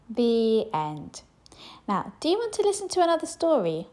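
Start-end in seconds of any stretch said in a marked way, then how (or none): 1.9-3.9 cheerful. Real, not acted. Cheery outro to children.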